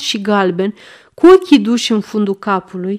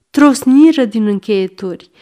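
ordinary delivery

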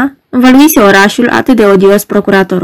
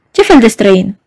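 usual speaking voice

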